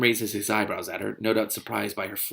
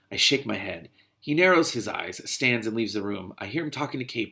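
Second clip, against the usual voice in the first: ordinary delivery, nothing marked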